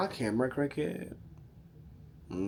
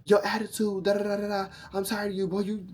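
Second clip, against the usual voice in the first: angry man; 6 semitones higher than usual. Retold or imitated. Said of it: "he said to me".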